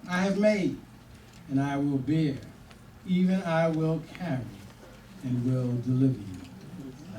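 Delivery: a touch of pulpit gravity; scripture reading. Acted. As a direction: read the verse like a preacher. Reads scripture in solemn style.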